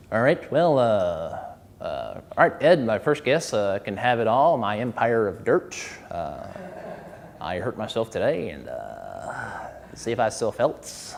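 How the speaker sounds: a lightly put-on low talk-show host mumbling the lyrics; half-speak the song like a late-night host, low and soft. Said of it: "imagined [name] singing this song".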